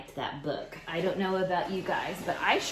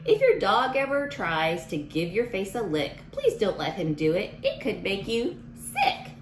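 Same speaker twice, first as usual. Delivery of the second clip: lively storybook read-aloud voice; reading to children; 3 semitones higher, 3 dB louder than usual. Acted. Teacher reading a rhyming book to kids, storybook voice. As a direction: read it out to the class with bounce.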